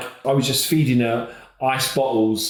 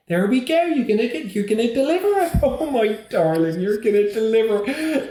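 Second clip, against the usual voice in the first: put-on high, cooing old Bristol midwife coaxing; Bristolian midwife; 10 semitones higher than usual. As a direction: coax the dad on, like a fussing Bristol nan. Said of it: "she was going".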